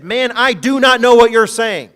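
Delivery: loud, high insistence from Peter; emphatic denial. Retold or imitated. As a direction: push the denial hard. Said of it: "He's getting emphatic".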